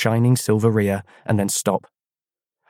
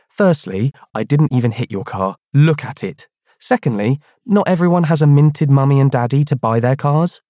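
put-on raised, angry girl's voice; louder, scolding classmate; indignant schoolgirl; 5 semitones higher, 5 dB louder than usual. Clara voiced in story.

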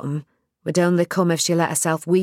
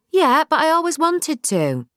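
much higher, pleading; wistful protest; 12 semitones higher than usual. Acted. Nell answering her dad, much higher and wistful. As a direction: reach up for it. Nell's voice.

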